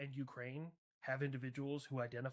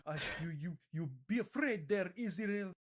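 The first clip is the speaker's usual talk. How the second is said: mock Iranian accent, slightly raised; 4 semitones higher than usual. Iran warns Israel to be afraid, in a mock Iranian accent. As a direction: warn haltingly in a put-on Iranian accent. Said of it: "so hard to say in this accent".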